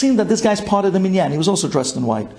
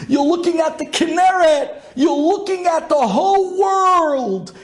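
awed excitement; 11 semitones higher than usual. Retold or imitated. Reliving overwhelming sunrise view.

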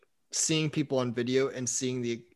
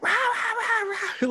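very high-pitched "rah rah" gibberish; squawky pretend shouting, all nonsense syllables; mock muted yelling. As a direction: imitate someone ranting soundlessly on video. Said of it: "yelling at somebody".

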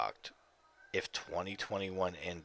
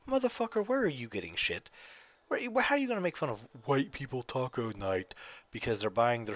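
mock outrage; 5 semitones higher than usual. Host rants at the TikTok taco video, mock indignant.

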